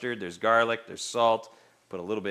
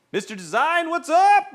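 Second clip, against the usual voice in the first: high and louder, hyped hello; excited greeting; 13 semitones higher, 6 dB louder than usual. Real, not acted. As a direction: shout the hello with a grin. Greeting a chat member loudly.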